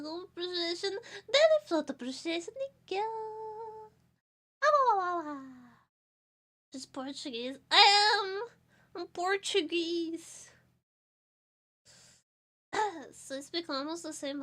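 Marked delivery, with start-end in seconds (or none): 4.6-5.4 silly wailing. Pretend. Silly yelp after thanks. 7.7-10.5 proud exclaim. Real, not acted. Proud declaration.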